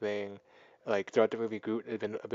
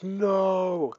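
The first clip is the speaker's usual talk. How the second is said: mock shout; 9 semitones higher, 6 dB louder than usual. Ego's outburst as bomb triggers.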